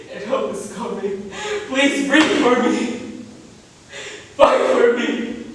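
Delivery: high, broken begging through tears; tearful plea. Acted. Son begging his dying mother, tearful and high. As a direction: plead with her to stay alive.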